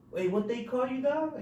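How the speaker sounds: high yelled question; inmate calling. Retold or imitated. Inmate repeating question to speaker, high call. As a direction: shout the question across.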